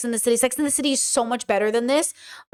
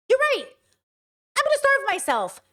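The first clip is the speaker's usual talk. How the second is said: mock sarcastic agreement; 10 semitones higher than usual. Mock agreeing to starve herself.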